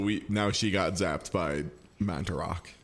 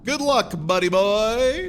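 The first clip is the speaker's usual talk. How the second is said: slow, high, loud mocking send-off; mocking farewell; 11 semitones higher, 5 dB louder, slower than usual. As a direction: taunt him slowly and loudly. Taunting farewell.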